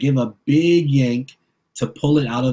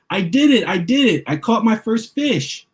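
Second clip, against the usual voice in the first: excited cheer; 9 semitones higher than usual. A narrator's reading. Boy landing his first fish, cheering excitedly. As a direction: shout it out in pure triumph.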